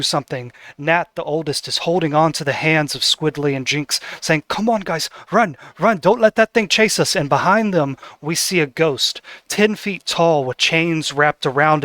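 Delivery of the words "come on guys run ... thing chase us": urgent plea. Acted. Nat urging siblings to flee.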